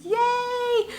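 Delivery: a quick delighted little cheer; excited yay. Real, not acted. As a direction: squeal it out, thrilled to start. Cheering her own series kickoff.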